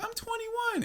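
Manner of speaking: high, soft mimicked girl voice; little blonde girl. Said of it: "this little blonde girl in front of me".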